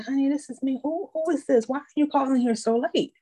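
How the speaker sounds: a hint of a fussy old granny; sweet old lady. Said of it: "You know, old lady voice".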